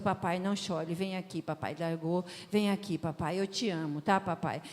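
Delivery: a hint of a small boy's soft "it's okay"; tender comfort. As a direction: gently soothe a crying dad. Child comforting crying father.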